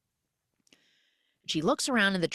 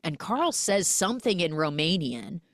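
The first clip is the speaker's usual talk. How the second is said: normal talking voice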